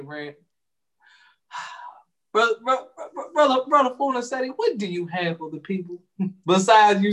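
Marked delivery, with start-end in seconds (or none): 2.3-4.8 high, exasperated stammer. Acted. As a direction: sigh and call him out in disbelief. Exasperated high call after a sigh.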